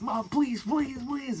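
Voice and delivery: put-on high, pleading child's whine; a kid begging mom over and over. Child begging mom to go, high whiny plea. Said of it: "So I was like".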